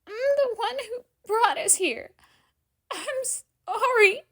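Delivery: high, loud, slow crying apology; piercing wail. A narrator's reading. Hazel Lou blaming herself to Danny, wailing. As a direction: wail through the tears. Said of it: "another piercing wail".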